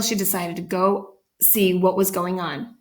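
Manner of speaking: ordinary delivery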